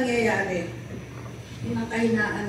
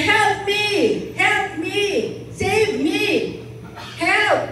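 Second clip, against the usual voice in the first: desperate cries for help; 10 semitones higher, slower than usual. Acted. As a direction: yell up from the cliff for rescue. Hiker calling from cliff.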